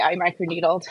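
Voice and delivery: ordinary talk, nothing marked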